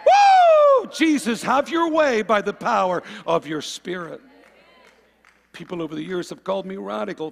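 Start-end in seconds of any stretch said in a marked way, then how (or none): 0.0-0.9 loud, high-pitched "woo"; exultant whoop. Acted. Preacher standing up with the saints to cry out, exultant whoop. 0.9-4.2 loud, raised cry of praise; rousing praise shout. Acted. Preacher voicing the saints' bold prayer to Jesus, rousing shout.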